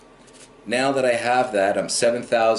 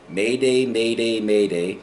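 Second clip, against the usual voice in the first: radio mayday call. Shown as a demonstration. Pilot calling an emergency.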